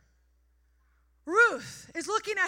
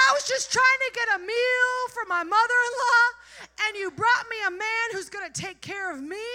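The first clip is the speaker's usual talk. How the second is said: higher, louder, voice breaking with thanks; tearful grateful prayer; 5 semitones higher, 8 dB louder than usual. Pretend. Preacher as Ruth, thanking God through tears. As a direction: weep out your gratitude to God. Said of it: "if I was Ruth, this is how I'd be".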